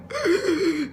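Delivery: sharp, high sobbing gasp; crying gasp. Retold or imitated. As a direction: suck in a breath mid-sob. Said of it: "because I'm crying at the same time".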